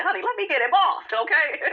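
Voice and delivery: high, sassy mock of a meddler; lightly mock her butting in; high sassy imitation, laughing at the end; mock Kenya. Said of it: "and said".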